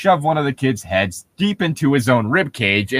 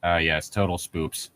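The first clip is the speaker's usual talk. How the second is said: flatly mock the word spoops; deadpan sarcasm; 9 semitones lower, 10 dB quieter than usual. Real, not acted. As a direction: quieter dry sarcastic drone.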